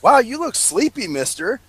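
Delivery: mock flirty, starting high; high, playful retold flirting; flirtatious. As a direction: imitate her teasing come-on, dropping on the last word. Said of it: "a little bit flirtatious".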